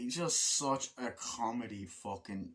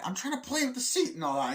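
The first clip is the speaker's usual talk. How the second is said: defensive protest; 12 semitones higher, 3 dB louder, faster than usual. Retold or imitated. Acts defensive passenger protesting.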